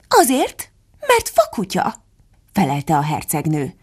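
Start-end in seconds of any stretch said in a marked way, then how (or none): 0.1-1.9 high-pitched, snippy Duchess; high, imperious retort; Duchess's curt reply. A narrator's reading. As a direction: answer curtly, pitched way up. Duchess's curt answer, acted.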